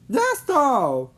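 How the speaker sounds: bright, loud and high call to go; rally the friends, loud and high; eager shout. Acted. Carl urging the boys to leave, eager shout.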